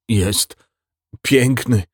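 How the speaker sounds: halting, with a long gap; awestruck, broken delivery; stammered out. A narrator's reading. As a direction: get it out word by word. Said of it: "wydukał kapitan".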